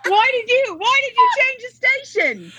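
very high mock British voice, breaking into laughter; attempted British accent. Retelling singer's complaint about the radio, laughing attempted British accent. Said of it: "I think that's British".

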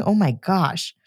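ordinary talk, nothing marked

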